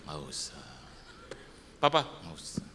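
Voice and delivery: father sulking that he buys nothing; sulky refusal. Retold or imitated. Sulky refusal, low and soft.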